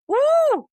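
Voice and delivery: squealed ooh, very high and loud; a delighted squeal at something to buy; excited high ooh. Retold or imitated. Mind spotting a buy, squealing ooh. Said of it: "my mind's like".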